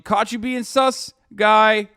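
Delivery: high, loud taunt; mocking government reaction. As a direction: draw out the gotcha, slowly and loudly. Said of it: "The Turkish government would be like".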